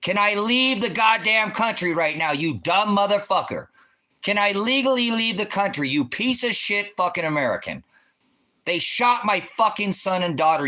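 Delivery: loud, high, raging demands; furious yelling. Real, not acted. Streamer yelling furiously at a chat viewer. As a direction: yell the question at him, enraged.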